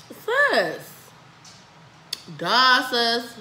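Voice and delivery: loud outburst. Real, not acted. Spicy noodle heat hits, outburst.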